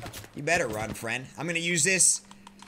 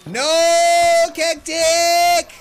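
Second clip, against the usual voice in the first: loud dismay; 6 semitones higher, 7 dB louder, much slower than usual. Real, not acted. Teammate goes down.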